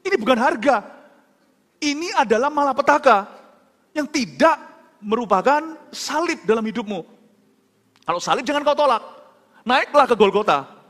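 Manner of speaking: high, loud, emphatic preaching voice; insistent retold rebuke, pitched high; impassioned protest. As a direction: drive each phrase home as a forceful objection. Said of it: "saya protes di hati saya".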